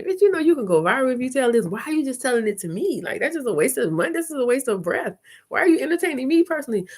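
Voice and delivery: high, quick, incredulous questioning; exasperated disbelief. Acted. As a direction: argue in a high, baffled rush. Acting out her exasperated inner response.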